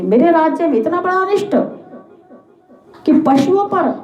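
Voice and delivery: mildly hammed-up righteous fury; indignant royal anger. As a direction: rebuke a wrongdoer, pitched high. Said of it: "Parikshit को बड़ा क्रोध आया".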